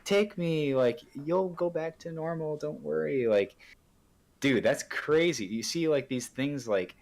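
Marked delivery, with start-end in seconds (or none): 0.1-3.3 high, coaxing mock voice of a pill; imitated whisperer, voiced high rather than hushed; whispering pill, imitated. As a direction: lightly play a tempting little spirit, pitched up high. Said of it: "Xanax literally whispering to you".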